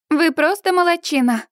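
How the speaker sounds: high, enthusiastic praise; gushing praise. A narrator's reading. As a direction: gush over him. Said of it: "хвалит Сьюзи".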